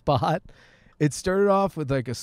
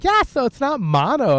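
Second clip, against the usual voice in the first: higher, louder chirpy staff voice; mocking clinic staff; 10 semitones higher, 5 dB louder than usual. Retelling the clinic returning with results, higher mock chirpy. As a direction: mimic the cheery nurse.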